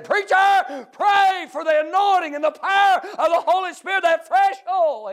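high, loud revival preaching cry; fervent shouted plea. Retold or imitated. Old soldier to young preacher asking prayer, shouted fervently. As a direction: cry it out urgently at full force.

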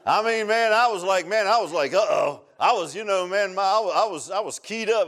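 loud, high retelling of panic; worked-up storyteller reliving his alarm; keyed-up alarm. Retold or imitated. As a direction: blurt it out, all worked up.

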